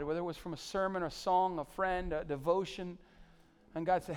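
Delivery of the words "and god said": normal talking voice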